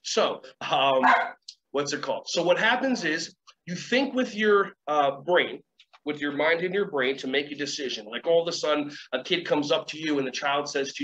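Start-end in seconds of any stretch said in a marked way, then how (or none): none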